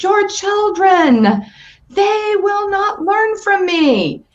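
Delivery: high, slow, fed-up wail; exasperated mother mouse. Acted. Mother mouse gives up on teaching the children, exasperated. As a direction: throw your hands up and plead. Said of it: "throws up her hands in exasperation".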